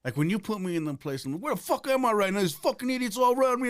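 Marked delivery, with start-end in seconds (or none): 1.4-3.7 high-pitched exasperated rant; mock bewildered outrage, voice pushed up high; acted indignant outburst. Comic telling of landing among idiots in jail, acted indignant outburst. Acts out being put in jail.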